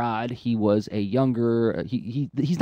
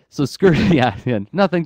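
higher, louder, laughing through the words; real laughing; 5 semitones higher, 7 dB louder than usual. Real, not acted. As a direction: carry on talking while cracking up. Laughing with listener at the bit.

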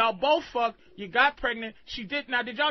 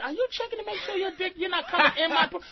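higher-pitched retold question; imitated questioning partner; 6 semitones higher, faster than usual. Comedian retells his partner's question mid-story, higher mock questioning. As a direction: lightly mimic her disbelieving query. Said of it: "You said".

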